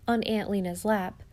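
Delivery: usual speaking voice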